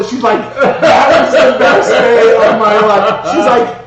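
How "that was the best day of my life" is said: slow, loud laughing quote. Retold or imitated. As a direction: laugh through each word.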